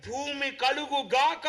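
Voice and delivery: slow, emphatic creation decree; drawn-out, ringing proclamation; forceful divine command. Acted. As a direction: call it out like God summoning the earth. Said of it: "గట్టిగా పిలిస్తే".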